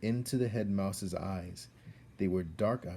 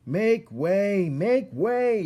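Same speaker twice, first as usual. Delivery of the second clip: high, loud call to clear the way; shouted; 13 semitones higher, 11 dB louder, much slower than usual. Acted. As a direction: bellow it out to part the crowd. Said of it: "shouted Furlow".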